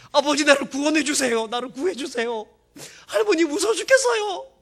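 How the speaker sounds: high, loud, mock-terrified begging; hammed-up desperate plea. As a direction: overplay a frightened boy crying for rescue. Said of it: "그렇게 한 게 아니고요". (과장되게 겁에 질린 애원)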